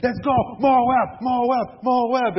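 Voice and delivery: rally the troops, chanting Moab; mock war chant of eager soldiers; excited battle cry. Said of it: "And they're all excited".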